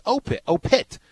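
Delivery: mock PA announcer calling "Opit" twice; hammed-up announcer call, "Opit, Opit", the S clipped off; dropped S. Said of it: "then he took away the S".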